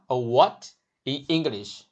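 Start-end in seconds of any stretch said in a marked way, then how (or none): 0.3-0.7 WHAT swept sharply upward like a question; rising question tone. Shown as a demonstration. Teacher likening a tone to an English question, WHAT rising. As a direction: ask WHAT with a rising pitch. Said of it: "Your voice naturally rises".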